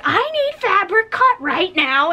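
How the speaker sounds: high, slow, pushy mock customer voice; demanding customer. Mocking a rude woman who interrupts, demanding fabric, high and slow. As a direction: drag it out like an impatient shopper.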